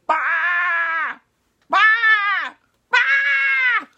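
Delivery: very high, loud lamb bleating; frantic high "baa" cries. Acted. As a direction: bleat shrilly for help. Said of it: "And he went".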